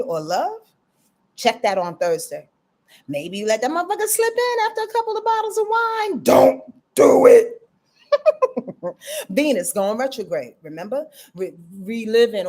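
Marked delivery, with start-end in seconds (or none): 3.1-6.2 very high, held-up squeal; mock confession voice. Host teasing viewers about a wine-fuelled slip, high mock voice. 6.2-7.5 loud, drawn-out hoarse warning; mock warning. Host warning viewers off an ex, loud mock warning. 8.1-9.2 high giggle after a joke; laughing. Real, not acted. Host amused by own mock warning, high giggle.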